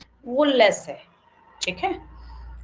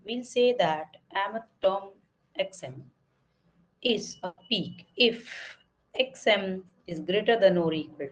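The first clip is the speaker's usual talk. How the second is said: slow, even reading of a theorem; slow textbook reading; 3 semitones lower, much slower than usual. Acted. Teacher reads theorem to students slowly. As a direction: read each word out slowly for the class. Reads definition aloud from text.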